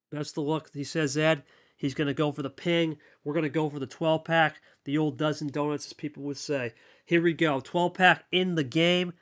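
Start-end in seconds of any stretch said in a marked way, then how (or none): none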